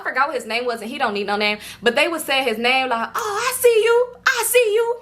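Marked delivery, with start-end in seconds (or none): 3.1-5.0 high, drawn-out hyping up; imitated crowd cheering a friend; happy hype greeting. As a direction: call out admiringly in a high voice. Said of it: "he just happy".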